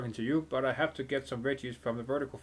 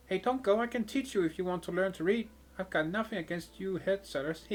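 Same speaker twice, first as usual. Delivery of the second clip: put-on much higher, teasing child; cheeky girl voice; 7 semitones higher than usual. Girl in the window taunting the mercenary, high cheeky voice. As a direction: needle the big guy like a brat.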